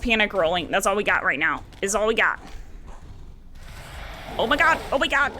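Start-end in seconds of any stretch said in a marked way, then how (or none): none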